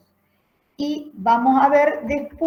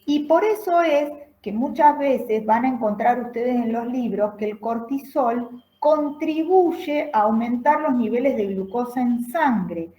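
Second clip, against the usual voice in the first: normal talking voice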